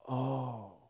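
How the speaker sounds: the penny drops; dawning oh. Acted. Peter realizing the truth, soft and low. Peter putting the dots together.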